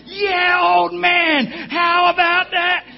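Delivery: triumphant fan shouting down the phone; gloating shout. Retold or imitated. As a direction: rub the win in his face. Gloating to father-in-law.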